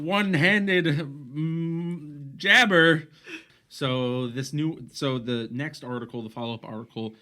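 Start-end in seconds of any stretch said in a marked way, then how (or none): 0.0-3.1 loud, high ring intro, slowing; boxing announcer. Pretend. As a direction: hype the fighter's stats. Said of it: "I started talking like a boxing, uh, announcer".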